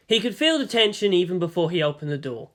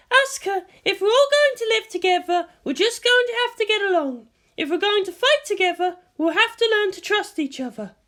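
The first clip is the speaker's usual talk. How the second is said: higher, steady, reasoning voice; calm guardian. A narrator's reading. Misato calmly reasons with Asuka, higher and steady. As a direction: coax them to get along. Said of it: "she was calm".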